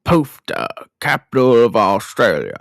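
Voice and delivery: put-on Australian accent; Mick Taylor. Mick mocks Ben's home town, put-on Australian accent. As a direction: say it as an amateur Australian impression. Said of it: "And Mick goes".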